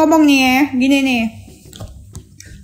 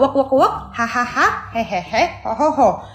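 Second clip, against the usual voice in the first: low, soft fake laugh in separate syllables; low, badly faked chortling; mock laughter; 6 semitones lower, 4 dB quieter, slower than usual. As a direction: imitate someone's silly laugh, low and deliberate. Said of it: "dia ketawa-tawa".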